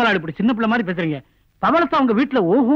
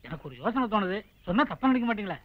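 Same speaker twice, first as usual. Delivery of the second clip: hushed, low and careful, testing the idea; hesitant hushed suggestion; 4 semitones lower, 9 dB quieter than usual. Acted. Friend cautiously pitches a plan to the hero, softly and low. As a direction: float the plan softly, afraid of offending.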